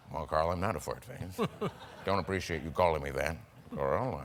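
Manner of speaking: put-on a deadly serious, low, soft old-timer; grizzled old businessman. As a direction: play it as a grizzled, earnest old man. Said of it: "grizzled, kind of old businessmen".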